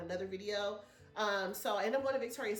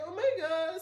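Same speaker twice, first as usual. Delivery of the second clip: high, loud retold gasp of joy; mock teary-eyed thanks; touched exclamation; 9 semitones higher, 7 dB louder than usual. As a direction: voice her overwhelmed delight. Retold lady overwhelmed by gift.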